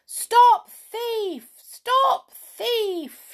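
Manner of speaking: very high, loud shout of alarm; high outraged holler, falling at each end. A narrator's reading. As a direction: yell after a fleeing thief. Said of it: "shouted the clown".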